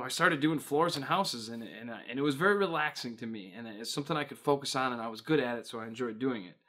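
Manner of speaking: ordinary talk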